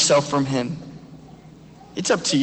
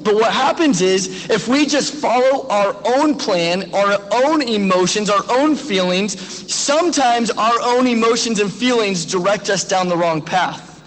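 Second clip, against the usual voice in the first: ordinary talk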